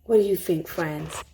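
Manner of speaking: plain reading voice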